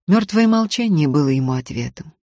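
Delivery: ordinary delivery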